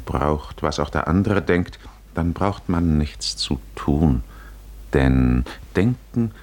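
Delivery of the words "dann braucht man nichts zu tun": ordinary delivery, nothing marked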